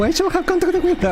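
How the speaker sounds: high, fast, breaking into laughter; giddy repetition dissolving into laughs; laughing. Acted. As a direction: squeal it out while cracking up. Repeating the line, cracking up.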